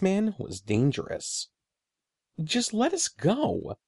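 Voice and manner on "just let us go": put-on high, slow pleading boy. A narrator's reading. Eddie begging the sorcerer, high slow plea. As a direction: beg slowly to be let go. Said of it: "Eddie pleaded again".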